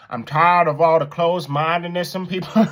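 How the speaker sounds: badly faked Southern accent, breaking into a laugh; hammed-up attempt at a Southern accent; horrible Southern accent. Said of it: "That was a horrible accent".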